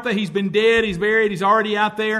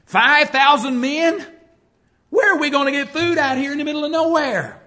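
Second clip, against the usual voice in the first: mock incredulous, louder and higher; incredulous disbelief; 5 semitones higher, 6 dB louder than usual. Preacher voicing apostles doubting Jesus, incredulous. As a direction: scoff at the impossible request. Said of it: "you can imagine, you gotta be kidding".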